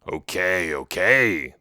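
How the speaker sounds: lightly voiced troll giving in; drawn-out creature "okay". A narrator's reading. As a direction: give in reluctantly as a troll. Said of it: "the troll said".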